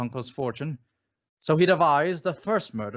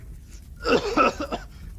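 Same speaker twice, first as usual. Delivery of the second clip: rough smoky coughing fit; coughing in the burning hall; choking cough. Acted. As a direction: choke on the smoke. Choking smoke.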